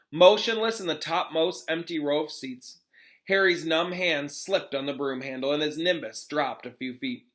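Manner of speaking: plain reading voice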